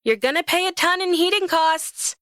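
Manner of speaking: high, singsong warning across the shop; called-out warning. A narrator's reading. Employee warning boss about open door, high call. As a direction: pitch it way up and warn them. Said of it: "I warn".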